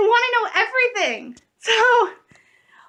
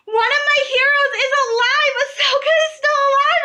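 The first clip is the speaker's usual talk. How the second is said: excited shouting; 8 semitones higher, 4 dB louder than usual. Real, not acted. Fan shouting joyful spoiler news.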